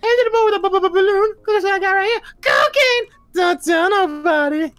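very high squeaky cartoon clown. Fan imitating a rock and roll clown in a high squeak. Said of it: "he's like".

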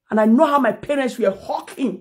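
ordinary talk

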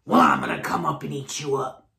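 low, fast troll threat; growling troll. A narrator's reading. Troll threatens middle goat.

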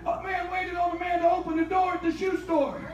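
loud, rising preacher's cry; preaching at full force. Acted. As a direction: preach it hard to the congregation.

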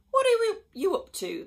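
high, loud exasperated question; exasperated demand. A narrator's reading. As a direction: demand an answer from the rascal. Harry confronts his cat.

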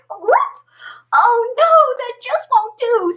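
high, loud "oh no"; dismayed frog. Acted. Frog upset at barking, high and loud. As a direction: cry out in dismay.

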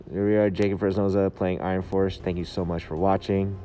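usual speaking voice

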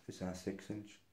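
low, very soft mumbled question; imitated rude grunt. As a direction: mimic a server grunting it out. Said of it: "she was, like, grunting at me".

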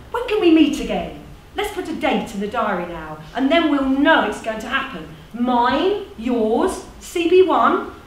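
brisk, animated meeting chair; rally them into fixing a date; eager organiser with lifted, punchy phrases; persuasive community organiser. Acted.

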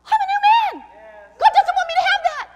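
very high, loud, ringing declaration; exultant new believer proclaiming; acted convert's cry. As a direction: shout it like a man just set free. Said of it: "you go".

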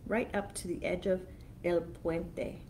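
ordinary delivery

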